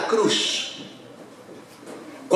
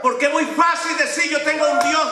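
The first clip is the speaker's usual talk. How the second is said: higher, fervent preaching; 6 semitones higher than usual. Acted. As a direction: lift the congregation with it. Preacher building his point.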